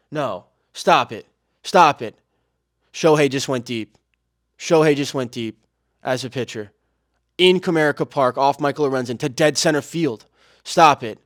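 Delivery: too excited. Real, not acted. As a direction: yell it out as a home run lands live. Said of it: "Got a little too excited there".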